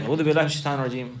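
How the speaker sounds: fast, rattled-off "A'udhu billahi minash shaitanir rajeem"; empty, rushed recitation; tongue-only rote recital. Shown as a demonstration. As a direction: race through the prayer without meaning it. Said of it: "like that with your tongue. You just say it like".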